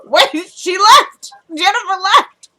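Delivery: high, loud, slow outraged disbelief; stunned disbelief. Retold or imitated. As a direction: drag it out like you can't believe it. Shocked reaction continues.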